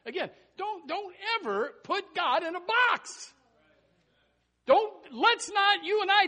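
loud, high, hammering exhortation; full-force preaching. Real, not acted. Preacher warning people not to box God in, full-force shout. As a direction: preach it hard, shouting each phrase.

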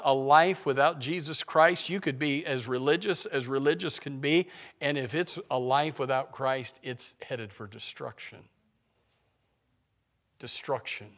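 ordinary talk

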